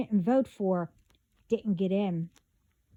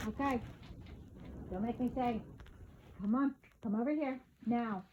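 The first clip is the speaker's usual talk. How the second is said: softer, stern parent calling from afar; calling from far away; 12 dB quieter, faster than usual. Real, not acted. Parent scolding child from afar.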